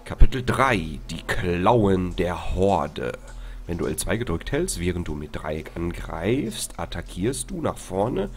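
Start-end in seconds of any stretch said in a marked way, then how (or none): 0.0-3.1 slow, weighty title announcement; dramatic title read. Acted. (langsamer, dramatischer Titel)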